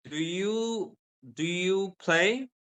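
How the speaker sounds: "do you play" slow, climbing high on "play"; rising question. Shown as a demonstration. Teacher shows intonation in a question, rising "play". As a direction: stretch "play" upward like a question. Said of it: "then my voice goes up".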